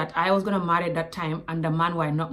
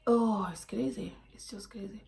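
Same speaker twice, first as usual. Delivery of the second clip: whisper; 8 dB quieter than usual. Real, not acted. Reacting to her date's behaviour, whispered. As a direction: whisper it, shaking your head. Disbelief at date.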